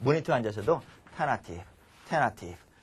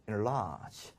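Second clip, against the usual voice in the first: softer, lower, graceful delivery; elegant; 4 semitones lower, 7 dB quieter than usual. Shown as a demonstration. Teacher models the proper word for students, elegantly. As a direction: say it with refined restraint, softly. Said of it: "우아하게".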